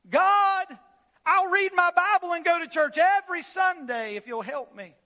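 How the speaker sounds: high, loud bargaining with God; stranded man promising God anything if saved; pleading shout. Acted. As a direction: bargain desperately, calling up into the dark. Man hanging from cliff root.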